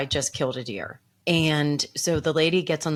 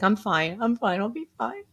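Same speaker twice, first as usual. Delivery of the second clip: tearful reassurance; 6 semitones higher than usual. Retold or imitated. Acted tearful reassurance to dispatcher.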